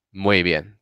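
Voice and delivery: normal talking voice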